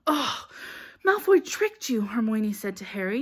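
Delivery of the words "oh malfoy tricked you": high, loud and put out; exasperated scolding. A narrator's reading. Hermione telling Harry he was tricked, exasperated. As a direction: scold a friend for being fooled. Girl scolds friend after escape.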